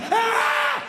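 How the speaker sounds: high shrill scream; mock scream. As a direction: scream in terror. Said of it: "I'm like".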